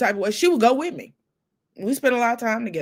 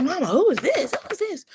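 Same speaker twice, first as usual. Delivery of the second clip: excited; 9 semitones higher than usual. Retold or imitated. Asking mother about photo.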